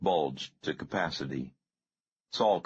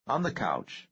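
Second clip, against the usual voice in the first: ordinary delivery, nothing marked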